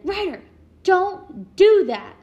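high, loud, slow yell across the house. Retold or imitated. Mother yelling at her son from across the house. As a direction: bellow it at a misbehaving kid. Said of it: "yell from across the house".

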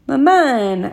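mildly bashful, pitch lifted; almost blushing. A narrator's reading. Son answers his doting mother, almost blushing. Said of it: "I said, almost blushing".

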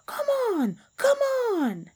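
very high, squeaky egging-on; mock cheering. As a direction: egg him on in a high squeal. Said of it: "Anansi shouted".

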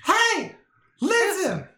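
very high, loud fairy cry; Navi voice. Pretend. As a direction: squeal it as high as you can. Mimicking Navi's call.

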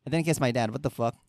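normal talking voice

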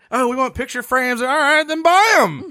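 high-pitched, exasperated retort; mocking and worked up, pitched high; mock exasperation. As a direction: act out an indignant comeback. Acted quarrel over housewarming gifts.

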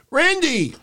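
squeaky high cry of the name; hammed-up high-pitched call; mock referee yelp. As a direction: yelp it way up high like a clueless ref. Mimicking referee calling Orton.

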